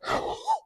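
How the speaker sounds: a sudden high gasp of surprise; sharp gasp. Real, not acted. Seeing the huge wrenches for the first time, gasping. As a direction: catch your breath in amazement. Unboxing giant wrenches for viewers.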